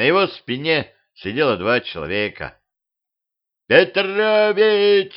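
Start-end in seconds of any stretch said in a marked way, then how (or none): 3.7-5.1 high, slow drawn-out call; yelling from above. Acted. Дед с дракона кричит Петровичу сверху, протяжный высокий зов. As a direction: bellow his name down from the dragon. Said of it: "заорал сверху дед".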